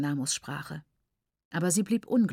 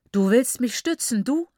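louder, scornful retort; hissed scorn; 5 semitones higher, 5 dB louder than usual. Acted. Mother hisses scornfully at the aunt who tries to hold her. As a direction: spit the words at her. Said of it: "zischte sie".

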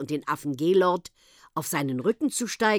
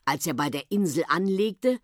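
ordinary delivery

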